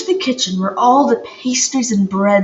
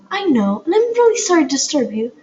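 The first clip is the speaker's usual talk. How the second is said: put-on high, fast, rushing girl's voice; eager young girl; 11 semitones higher, much faster than usual. A narrator's reading. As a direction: rush the plea out like a nervous kid. Poppy pleads with the shopkeeper.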